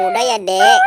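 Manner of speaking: plain reading voice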